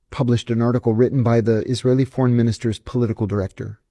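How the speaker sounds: ordinary talk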